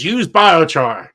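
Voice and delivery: high, loud proclamation; loud slogan. Retold or imitated. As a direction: call out the advice, stretching the words. Calling out a quoted slogan.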